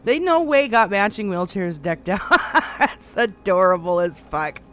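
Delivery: loud gleeful no way, then a laugh; delighted laughing. Real, not acted. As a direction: cry out in gleeful disbelief. Delighted by matching wheelchairs.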